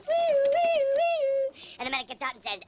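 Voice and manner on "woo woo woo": high, loud woo siren wail; siren imitation.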